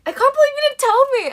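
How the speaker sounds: very high, loud exclamation; happy disbelief. Retold or imitated. Mum to the daughter who kept a secret, happy disbelief. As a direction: imitate mum's excited squeal. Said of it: "she was really happy about it".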